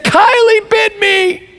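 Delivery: put-on high drawn-out wail of a little girl; mock crying child. Tearful three-year-old tells her parents about her sister, mock crying. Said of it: "She's crying".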